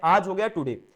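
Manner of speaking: usual speaking voice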